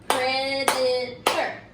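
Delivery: Predator split into slow beats, one per clap; slow clapped syllables. Shown as a demonstration. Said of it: "say the word and clap the word".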